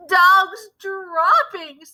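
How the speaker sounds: high, slow drawn-out cry; slow dreaded cry. Acted. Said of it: "they cried".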